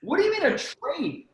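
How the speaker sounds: high, incredulous "a trade?"; stunned, asking back in shock; shocked disbelief. Retold or imitated. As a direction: pitch shoots up in outraged disbelief. Retells his shocked reply.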